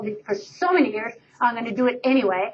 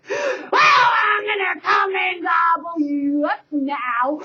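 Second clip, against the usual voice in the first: troll roar; 5 semitones higher, slower than usual. Acted. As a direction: growl the threat slowly. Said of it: "roared the troll".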